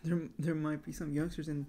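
chewing between words; talking around a spoon; mouth full. Real, not acted. Seen 0: Spoon of cereal held at mouth, chewing throughout the labelled words.

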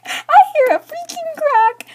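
very high, loud, drawn-out wail; hysterical outrage. Retold or imitated. Vlogger reliving the phone crack, hysterical outrage. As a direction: shriek each word out slowly in horror.

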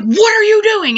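mock the brother's panicked outburst; brother yelling. Brother yelling at her while she drives, alarmed. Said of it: "my brother's like".

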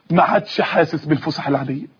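ordinary talk, nothing marked

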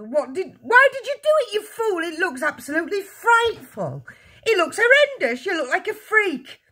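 very high, shrieking scolding. A narrator's reading. Mother horrified by husband's frightful hair, shrieking. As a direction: berate him at screeching pitch. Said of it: "shrieked the mother".

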